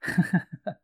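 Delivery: short burst of laughter. Real, not acted. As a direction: laugh out loud briefly. Laughing before joke about knees.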